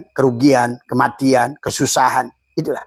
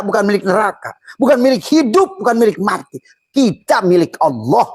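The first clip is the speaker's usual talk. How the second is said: building sermon litany; 6 semitones higher than usual. Acted. Preacher telling listeners they belong to God, building litany. Preacher's chanted list of contrasts.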